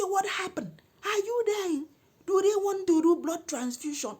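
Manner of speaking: loud, very high questioning; indignant demand. Retold or imitated. Woman calling back after sixteen calls, indignant. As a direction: demand answers in outrage. Retold furious call back.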